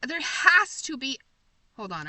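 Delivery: ordinary talk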